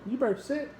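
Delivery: high-pitched pet-talk order; playful dog command. Real, not acted. Owner telling the dog to sit, high playful command. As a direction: coax the dog to obey in a high voice.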